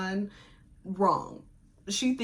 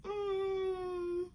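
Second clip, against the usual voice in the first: mock soft hum; 11 semitones higher, 8 dB quieter than usual. Shown as a demonstration. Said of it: "it was more like".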